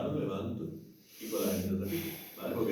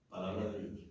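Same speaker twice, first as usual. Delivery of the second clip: falling, firm statement; affirming; 3 semitones lower, 10 dB quieter than usual. Shown as a demonstration. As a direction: state it as a fact, pause first.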